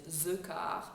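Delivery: "ze car" with a throaty French R; thick French "the car", Z for TH; heavy French accent. Shown as a demonstration. Coach repeating her example of the heavy French accent. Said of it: "this is the, the heavy accent".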